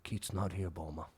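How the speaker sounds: very low, very soft stoned voice; stoned mumble. Retold or imitated. Stoned friend refusing to leave, very low and soft. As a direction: mumble it low and barely there. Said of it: "stoned friend answering".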